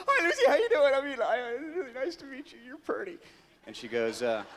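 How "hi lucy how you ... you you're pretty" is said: higher, giggly, eager gushing; gushing fan. Retold or imitated. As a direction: fawn over the co-star. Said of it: "And I'm like".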